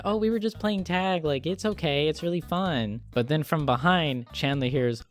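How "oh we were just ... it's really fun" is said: put-on higher, sweet little-kid voice; sweet child. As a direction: play an innocent kid reassuring an adult. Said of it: "really sweet, and he's just like".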